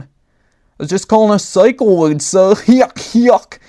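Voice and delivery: goofy clown giggling through the line; clown laughing. A narrator's reading. Clown answers the commander, laughing goofily. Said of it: "the baseball clown laughed".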